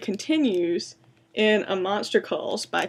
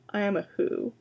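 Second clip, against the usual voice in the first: plain reading voice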